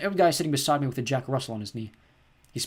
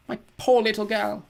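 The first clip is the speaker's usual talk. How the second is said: higher and louder, slow, near tears; tearful; 10 semitones higher, 6 dB louder, slower than usual. A narrator's reading. Mum grieves for her baby girl, slow and tearful. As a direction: grieve over the sick baby.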